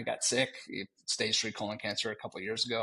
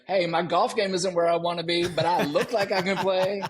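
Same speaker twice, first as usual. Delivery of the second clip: laughing; 6 semitones higher, 6 dB louder than usual. Retold or imitated. Acting a cocky golfer.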